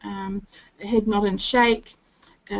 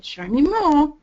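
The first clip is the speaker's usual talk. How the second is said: louder, higher push on show me more; pressuring prompt; 6 semitones higher, 6 dB louder, slower than usual. Shown as a demonstration. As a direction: demand a repeat. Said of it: "putting pressure on him".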